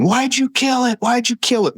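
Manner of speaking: high-pitched mock scream of a sobbing boy; imitating crying boy. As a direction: squeal it up high like a tearful ten-year-old. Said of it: "he's screaming".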